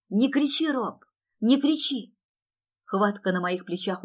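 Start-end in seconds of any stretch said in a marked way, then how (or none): 0.1-2.1 high, raised, urgent plea; drawn-out, loud begging; pleading cry. Acted. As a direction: beg him over his shouting. Sister begs raging brother.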